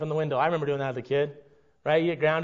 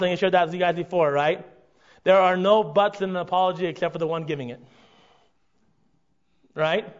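normal talking voice

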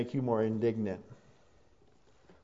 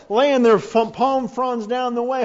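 loud, raised storytelling from the pulpit; forceful preaching; 9 semitones higher, 9 dB louder than usual. Real, not acted. Preacher describing palms laid before Jesus, loud and high. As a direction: keep the voice up over the cheering.

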